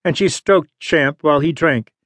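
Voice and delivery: usual speaking voice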